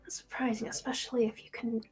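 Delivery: soft, whispery character voice; hushed dismay. Acted. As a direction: mutter over it, half to yourself. Herbalist mutters over plant's price.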